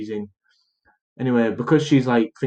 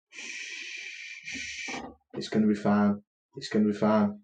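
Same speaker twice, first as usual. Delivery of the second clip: imitated soothing hush; 7 dB quieter than usual. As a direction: hush them gently, acting eerily calm. Mother hushing family at gunpoint.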